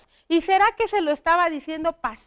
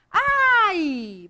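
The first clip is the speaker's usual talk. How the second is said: mock cry of pain; 5 semitones higher than usual. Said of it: "Grita también".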